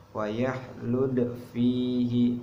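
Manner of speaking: Wa yahlud fihi recited slowly, the d bouncing lightly; small qalqalah. Shown as a demonstration. Teacher demonstrates qalqalah sugro, slow recitation. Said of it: "Cara bacanya seperti ini".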